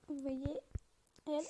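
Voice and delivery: ordinary delivery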